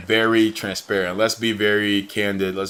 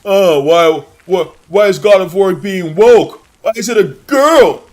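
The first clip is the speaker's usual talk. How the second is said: high, loud mocking whine of an angry gamer; mocking whiny gamer; 9 semitones higher, 9 dB louder than usual. Streamer mocks gamers upset about a female character, in a high loud whine. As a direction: squeal it like a sulking man-child. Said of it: "everyone is losing their heads".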